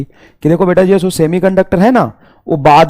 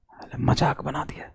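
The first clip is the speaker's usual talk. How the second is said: slow breathy whispered aside; 6 semitones lower, 16 dB quieter, slower than usual. Real, not acted. Teacher muttering to himself after the mockery, whispered. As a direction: drop to a hushed whisper.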